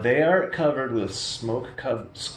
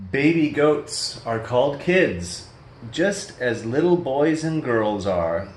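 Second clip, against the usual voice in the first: usual speaking voice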